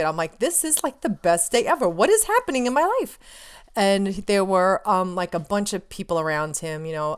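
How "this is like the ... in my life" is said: high-pitched, re-enacted amazement; giddy disbelief. Fan recalling the best day ever, giddy disbelief. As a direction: rise into a giddy, incredulous squeal.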